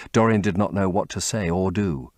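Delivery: usual speaking voice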